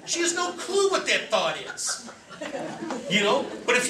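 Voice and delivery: laughing mid-sentence at the mom bit. Real, not acted. Laughing through his comment.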